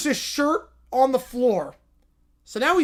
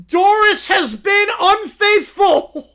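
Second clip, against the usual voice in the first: loud, slow, high wounded declaration; anguished booming proclamation; 6 semitones higher, 8 dB louder, slower than usual. Acted. Boris tells the whole arena his wife cheated, loud and slow. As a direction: announce your heartbreak to the arena. Boris announces wife's infidelity.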